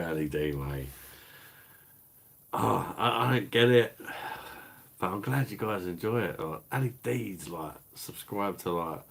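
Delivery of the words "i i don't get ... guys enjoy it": normal talking voice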